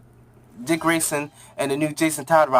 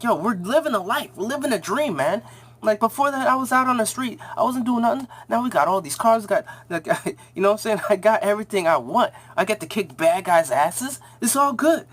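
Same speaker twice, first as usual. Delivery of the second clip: higher, hyped boast; bragging; 6 semitones higher than usual. Retold or imitated. New Robin bragging to the old Robin, hyped. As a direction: show off like a kid who made it.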